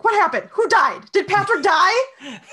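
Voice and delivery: high, loud panicked questions, hammed up; mock-panicked outburst; startled awake. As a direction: imitate someone jolted awake in alarm. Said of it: "he sort of startles awake".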